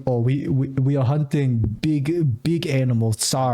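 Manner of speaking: mock heavy Russian accent, drawn out. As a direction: imitate a Russian hunter father's accent. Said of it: "his father's a heavy Russian accent".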